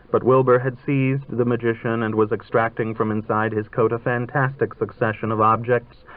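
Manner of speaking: plain reading voice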